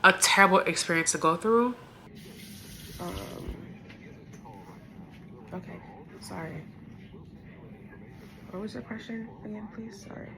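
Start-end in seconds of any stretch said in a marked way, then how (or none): 3.0-10.3 much softer, worn out voice; quiet, holding back tears; emotional, subdued. Real, not acted. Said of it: "I'm getting emotional again".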